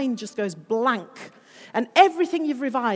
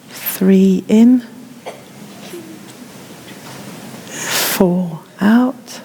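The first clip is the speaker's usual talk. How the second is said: guided breathing count; 3 semitones lower, 5 dB louder, slower than usual. Acted. Teacher counting breaths for pupils, slow and calm. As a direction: ease the class through each breath.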